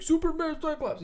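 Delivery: high-pitched excited squeal of fan recognition; giddy, high fanboy outburst; mock excited exclamation. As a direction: squeal the names like a thrilled fan. Quoting own excited first reaction.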